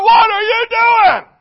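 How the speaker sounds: high, loud, slow mock shout; parent yelling. Parent in the stands yelling at the child, slow mock shout. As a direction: drag it out like an exasperated parent.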